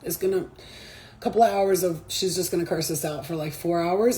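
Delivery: low, soft, resigned onlooker murmur; unbothered spirit guides. Retold or imitated. Spirit guides watching her rant, unbothered and hushed. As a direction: imitate guides patiently waiting out a rant. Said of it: "they be watching me like this".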